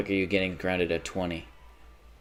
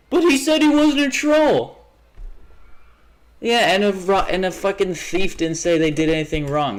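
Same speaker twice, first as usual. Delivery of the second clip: much higher and louder, squeaky mocking; mock viewer voice; 10 semitones higher, 5 dB louder than usual. Streamer mocking a viewer defending the troll, squeaky mock voice. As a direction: parody the objecting viewer.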